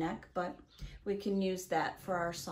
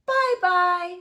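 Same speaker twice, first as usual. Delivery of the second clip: loud, high bye-bye; big singsong send-off; cheery farewell call; 9 semitones higher, 12 dB louder than usual. Acted. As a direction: call goodbye to a group of children. Everyone calls goodbye in the book.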